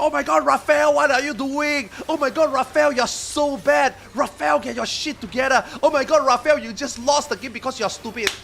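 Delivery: put-on high, loud taunting teen voice; mocking kid. Dad imitates his son taunting Raphael, high and loud. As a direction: imitate a kid razzing a friend. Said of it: "He's always, like, making fun".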